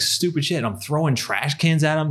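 ordinary delivery

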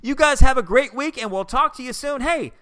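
high, bright podcast host outro; upbeat sign-off. Acted. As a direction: wrap up the show with energy.

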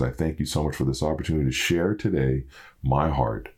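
grieving son praying quietly for his father and all who mourn; prayer. Real, not acted. Heartfelt spoken prayer.

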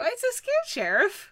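high sing-song teasing, falling on the last word; mock taunt. Teasing the sheriff about just a guy, high mock taunt.

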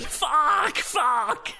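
high mock-panic scream; panicked screaming. Air steward as the engines go, panicked screaming. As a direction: scream it like the plane is going down.

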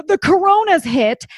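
hammed-up Karen panic; mock Karen panic. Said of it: "and was like".

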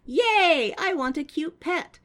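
put-on high, gleeful child voice; cute kid voice. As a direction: squeal it like an excited little kid. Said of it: "Ducky is like".

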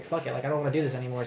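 usual speaking voice

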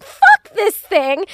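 high, loud, slow yell of panic; panicked shout at the bug; retold scream. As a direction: yell it at the wasp. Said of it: "I'm screaming".

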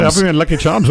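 high mock-Irish accent; bad Irish accent. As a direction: echo it in a badly faked Irish accent. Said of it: "I cannot do an Irish accent".

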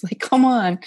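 loud, laughing come on; laughing exasperation. Real, not acted. Laughing about schools not teaching DBT, loud and exasperated. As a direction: laugh it off, fed up but amused.

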